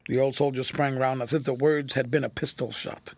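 ordinary delivery

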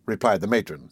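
ordinary delivery

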